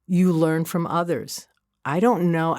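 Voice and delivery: ordinary talk, nothing marked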